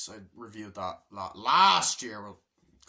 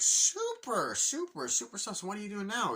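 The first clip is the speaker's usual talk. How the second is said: very high, loud super super; gushing salesman; 10 semitones higher, 6 dB louder than usual. Retold or imitated. Salesman gushes to his seatmate, very high and loud. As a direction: gush with overeager cheer.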